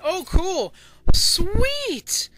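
loud, high delighted exclamation; excited cheer. Real, not acted. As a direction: cheer a lucky surprise. Delighted that items were kept.

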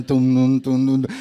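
loud, low thumping "tum, tum, tum"; movie suspense thuds. Retold or imitated. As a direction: beat out the horror-film music with your voice. Las películas te avisan. (golpes de suspenso imitados, fuertes)

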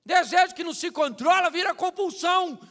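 loud, slow sermon shout; slow, loud preacher's declaration; full-force preaching. Acted. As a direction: hammer each word from the pulpit.

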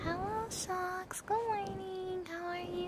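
high, slow, sweet greeting; cute high voice. Shown as a demonstration. Streamer greeting a chatter in a cute high voice. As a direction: lift it up and sound cute. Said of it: "So I'm happy and cute".

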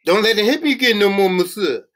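high, loud, fast pleading; pleading cry. A narrator's reading. Prisoner begging not to be hit, high frantic pleading. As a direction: beg for protection in a high voice. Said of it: "Devereaux bribed".

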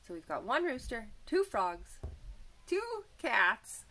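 bright, lively storybook voice; reading to children. A narrator's reading. Teacher reading a picture book to children, lively. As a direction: read it like a teacher to little kids.

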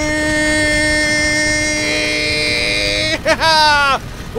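high, loud laugh that falls away; a real, long laugh of glee; long triumphant laugh. Real, not acted. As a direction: let out a long victorious cackle. Laughing after victory.

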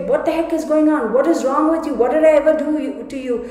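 fast, high, loud bewildered pleas; frantic pleading. Retold or imitated. Victim confronting her attacker in disbelief, fast frightened pleading. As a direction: rush the questions out in panic. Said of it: "she's like".

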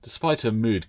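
ordinary delivery

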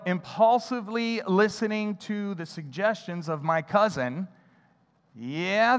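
ordinary delivery

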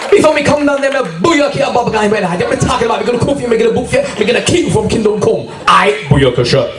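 high, fast mock yardie threat; Jamaican patois. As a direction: shout it in a Jamaican accent, fast. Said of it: "I have to go like this".

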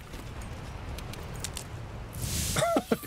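soft, wheezy high laugh; a long soft wheeze breaking into laughter; disbelieving laugh. Real, not acted. Gamer at a loss for words, laughing weakly.

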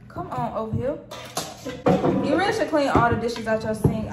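ordinary talk, nothing marked

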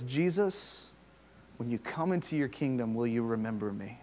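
soft, quiet humble request; humble plea. Retold or imitated. Thief on the cross asking Jesus quietly and humbly. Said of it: "he asks humbly".

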